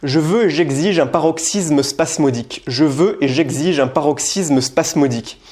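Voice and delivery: paroxysme spasmodique spoken slowly, every consonant crisp; slow drill of Je veux et j'exige; careful tongue twister. Shown as a demonstration. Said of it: "je vais t'en faire quelques-unes". (articulation lente de paroxysme spasmodique)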